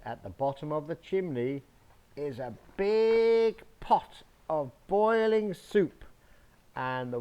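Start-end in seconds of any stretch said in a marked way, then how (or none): 2.2-6.0 higher, louder and slow; dramatic reveal. A narrator's reading. Parent reveals the boiling soup to the child, dramatic and slow. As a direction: build the surprise for the child.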